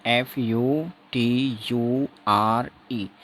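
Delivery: letters read slowly one by one; spelling out. Shown as a demonstration. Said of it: "इसका उच्चारण क्या करेंगे".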